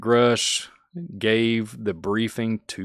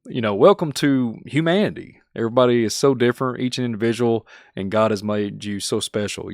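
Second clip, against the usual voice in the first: usual speaking voice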